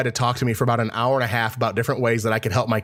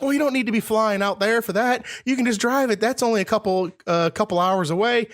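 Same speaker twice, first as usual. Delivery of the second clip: persuading salesman; 9 semitones higher than usual. Retold or imitated.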